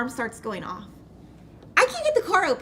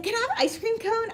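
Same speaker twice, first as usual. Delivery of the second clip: put-on much higher, eager little-kid ask; 10 semitones higher than usual. At the drive-thru window asking for ice cream, childlike and high. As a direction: ask for the cone like an excited child. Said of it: "I was, like, a kid".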